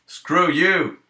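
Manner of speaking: "Screw you!" yelled loud and high at the viewer; mock angry outburst. Streamer jokingly snaps at a viewer who found nothing funny, mock-angry yell. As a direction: playfully lash out, loud and sharp. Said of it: "No, I'm, I don't mean this".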